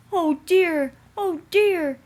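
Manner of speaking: high, loud mock groan; groaning trucks. A narrator's reading. Trucks groan after the crash, high and loud. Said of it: "they groaned".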